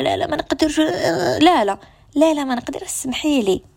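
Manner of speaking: high, fussy refusal; over-apologetic no. Retold or imitated. Mimicking a friend who refuses too much, fussy apology. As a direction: overdo the sorry. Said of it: "ماشي حتى تقولي".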